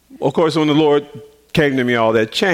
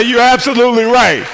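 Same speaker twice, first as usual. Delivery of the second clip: much higher, louder, thrilled; excited shout; 8 semitones higher, 5 dB louder than usual. Retold or imitated. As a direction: shout your agreement. Excited affirmation, laughing.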